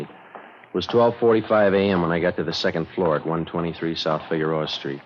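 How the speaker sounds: low, dry first-person narration; detective narration. A narrator's reading. Friday narrating his night watch to listeners, low and dry. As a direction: lay out the facts like a tired cop.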